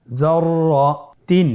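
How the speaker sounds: যররাতিন stretched slowly with the doubled র held; slow drawn-out reading. Shown as a demonstration. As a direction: draw out যররাতিন. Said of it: "আমার সাথে বলুন". (ধীরে টেনে যররাতিন)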